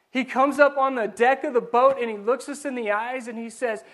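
usual speaking voice

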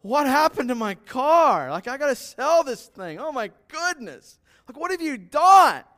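shocked, high, loud outburst; upset dealer. Retold or imitated. Dealer shouting at the muddy car returned. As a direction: cry out in horror at the ruined car. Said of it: "the guy at the dealership's going".

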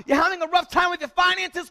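fiery pulpit exhortation; full-force preaching. Acted. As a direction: preach it to the back row. Preaching the storm passage.

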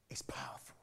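soft, breathless whisper. Acted. Preacher to congregation, dramatic whisper. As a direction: let it fall to a whisper.

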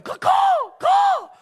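loud high crow calls; crow caw. Retold or imitated. Preacher cawing back at the crows. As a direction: caw right back at them. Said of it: "I'll go right back at 'em".

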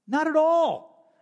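high, slow and loud: not at all; emphatic denial. Real, not acted. Preacher correcting his old reading, high slow emphatic denial. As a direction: stretch out a firm refusal.

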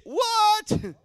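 "What?" very high and loud with a falling end; indignant falling shout of "What?"; outraged what. Shown as a demonstration. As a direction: demand the crowd echo it, loud. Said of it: "Some of you need to say".

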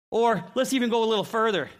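usual speaking voice

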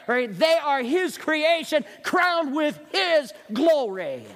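slow, drawn-out ringing phrases; slow climax. Acted. Pastor slowing at the sermon climax, drawn out. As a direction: stretch "crowned with his glory" out slowly.

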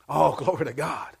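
usual speaking voice

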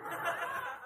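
a short high burst of laughter; real laugh. Real, not acted. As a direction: laugh out at the memory. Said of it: "I thought it was kind of humorous".

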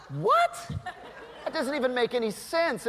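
high, astonished "What?"; mock disbelief. Dad reacting to his daughter wanting the ride again, astonished. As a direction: play the baffled dad, amazed.

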